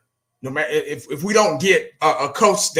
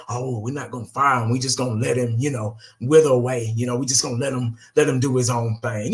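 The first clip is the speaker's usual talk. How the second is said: lower, softer mock owner voice; mock front office excuse; 6 semitones lower, 3 dB quieter than usual. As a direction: imitate the owners brushing it off, low and soft. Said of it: "You know what I'm saying".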